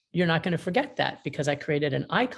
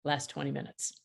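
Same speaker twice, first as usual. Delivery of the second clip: lasts twenty minutes dropped much softer; hushed repeat; 4 semitones lower, 10 dB quieter than usual. Shown as a demonstration. Speaker to mediators, softly stressing the fact. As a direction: let it fall to a near hush.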